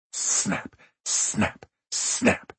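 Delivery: breathy whispered snaps. A narrator's reading. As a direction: whisper each snap, voiceless. Killer's inner thrill at kills.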